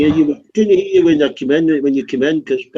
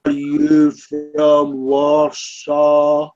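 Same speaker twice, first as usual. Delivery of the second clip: words stretched out for a poor listener; very slow repeat; much slower than usual. Real, not acted. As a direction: draw out every word, very slowly. Repeats slowly for someone not hearing.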